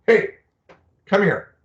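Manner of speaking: "Hey" called out high and loud; a parent calling a kid across the room. Retold or imitated. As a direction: call the child over. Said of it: "look at our kid and say".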